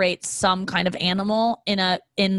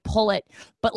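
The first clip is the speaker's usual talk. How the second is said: usual speaking voice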